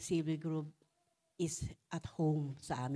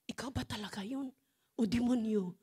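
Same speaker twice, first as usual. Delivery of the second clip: husband's startled question; 6 semitones higher than usual. Retold or imitated. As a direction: ask it fast and unsettled, like he doesn't recognise her. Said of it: "Sabi niya ganun". (asawang gulat na nagtatanong, mabilis at mataas)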